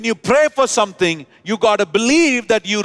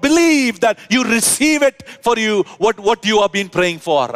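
full-force preaching; 6 semitones higher than usual. Real, not acted. Preacher exhorting the church to hold faith, high forceful preaching. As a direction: lift it up and drive it home.

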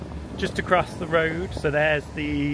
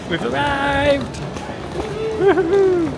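excited cheer; 13 semitones higher, 6 dB louder, slower than usual. Real, not acted. Arriving at resort, cheering.

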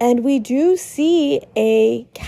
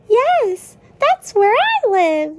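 much higher goofy Lancer voice; 8 semitones higher, 3 dB louder than usual. Acted. Narrator voicing Lancer proud of his home, high and goofy. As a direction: pitch way up into Lancer. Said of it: "Lancer says".

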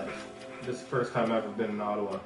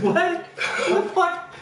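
laughing shocked disbelief; 13 semitones higher, 4 dB louder than usual. Retold or imitated. Retold shock at being in Quebec.

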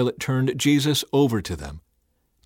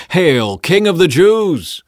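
loud, high, drawn-out mock salute; taunting; 7 semitones higher, 9 dB louder, slower than usual. A narrator's reading. Soldiers saluting Jesus in a crown, taunting. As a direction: hail him loudly in mockery. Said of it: "saluted him and taunted".

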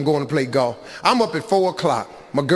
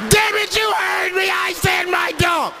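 high shouting woman's voice; shrieking high demand; irate woman; 12 semitones higher than usual. Retold or imitated. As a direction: scream it like an angry guest.

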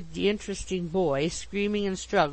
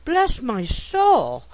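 slow, loud, higher exclamation; astonished cry; 6 semitones higher, 7 dB louder, much slower than usual. A narrator's reading. Mister Pickwick suddenly embraced by his landlady, astonished cry. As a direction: cry out aghast, stretching each word. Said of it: "cried the astonished Mister Pickwick".

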